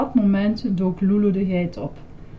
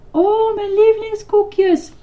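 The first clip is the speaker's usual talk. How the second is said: high, drawn-out loud squeal; exclaiming; 12 semitones higher, 6 dB louder than usual. A narrator's reading. Lucretia gets her favourite cookies, exclaiming. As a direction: squeal with delight. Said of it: "riep Lucretia uit".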